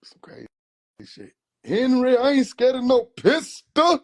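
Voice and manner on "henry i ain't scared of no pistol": high, loud, slow boast; uncle's defiant boast. Retold or imitated. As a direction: throw it back at him, loud and high. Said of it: "That's how he said it".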